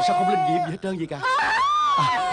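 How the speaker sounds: frantic laughing yell. Acted. Magic pearl fails, panic.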